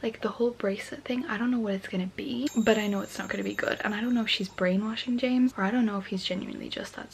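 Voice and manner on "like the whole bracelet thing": late-night whisper; hushed night-time vlog whisper. Real, not acted. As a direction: whisper so as not to wake anyone.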